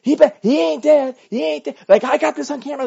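high, loud mock shout; imitating the wife. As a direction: yell it like the wife at the scene. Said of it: "she was like".

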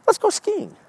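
high-pitched squeaky slogan voice; high mock cheer. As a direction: pipe it up high like an eager invitation. Voicing what Minnesota says.

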